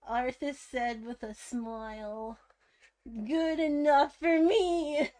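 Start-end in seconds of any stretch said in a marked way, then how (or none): 3.1-5.2 giggly excited reply; laughing through the words; cheerful half-laughing reply. Acted. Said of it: "Varian replied excitedly".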